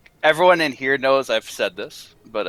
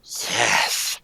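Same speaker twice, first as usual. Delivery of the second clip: ordinary talk, nothing marked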